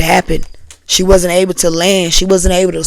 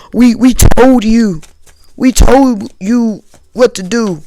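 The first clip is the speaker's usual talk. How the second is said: slow, louder parental lecture; scolding parents; 4 semitones higher, 4 dB louder, slower than usual. Pretend. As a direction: drag out each word like disappointed elders.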